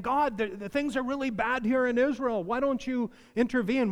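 high, pleading cry to God; pleading prophet. Retold or imitated. Preacher voicing Habakkuk pleading with God, high. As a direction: voice Habakkuk begging God to act. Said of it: "He's saying".

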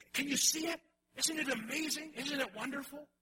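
high, amazed outburst voicing John; awed exclamation. Preacher voicing John marvelling at what he sees, amazed. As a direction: gasp it out like he can't believe his eyes. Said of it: "and he's saying".

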